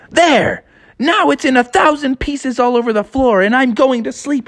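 high, huffy sister voice. Acted. Sister done with Froggy, high and huffy. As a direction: huff it out up high. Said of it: "And she said".

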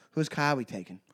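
low, quiet Boston movie line; understated Boston tough-guy quote; Boston accent. Acted. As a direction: toss it off softly in a Boston accent. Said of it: "looks away and looks back and goes".

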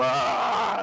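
loud drawn-out "aah" to scare someone; mock battle scream, half laughing; imitated attack yell. Showing how he charged out of the kitchen, mock yell. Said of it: "salí gritando algo así como".

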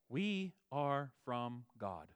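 slow, weighty "We" leaned on hard; stress on we. Shown as a demonstration. Pastor showing the congregation that John stresses "We", slow, leaned-on word. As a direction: press down on "We" and slow the whole line. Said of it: "the emphasis here is we".

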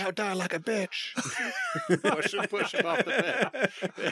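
mock villain. Mock game villain line.

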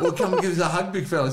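high, loud laughing offer of a hug; laughing invitation. Retold or imitated. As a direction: chuckle the invitation out.

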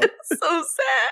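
high, slow hammed-up crying; mock sobbing. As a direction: wail it in fake grief. Mock grief over butterfly.

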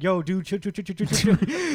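high, loud bro telling him to chill; hammed-up bro pleading chill chill; frat bro. [name] acted calming Iceman.

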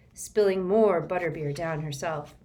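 ordinary delivery

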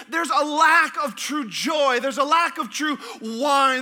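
loud, drawn-out preacher's cry; preaching shout. Real, not acted. Pastor preaching to the church, loud and drawn out. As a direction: hammer the lack home to the congregation.

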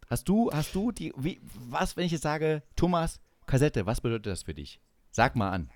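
usual speaking voice

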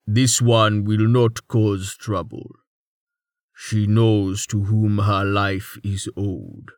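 ponderous, measured antlered giant; slow rumble. A narrator's reading. Savidian fixing the patient with a stare, slow rumble. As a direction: rumble each word out slowly and evenly. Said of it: "The Savidian rumbled".

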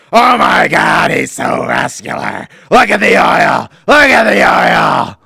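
high, booming shout of amazement; excited shouting. Acted. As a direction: gush at full volume over the muscles. Mock gushing over lifter's muscles.

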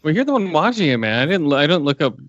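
normal talking voice

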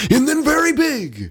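high and loud, big and booming. Shown as a demonstration. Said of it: "the way that he gets very small".

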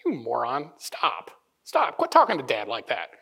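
lightly voiced scolding big brother; dropping low, curt telling-off; older brother. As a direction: chide a younger sibling. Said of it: "it's like your older brother".

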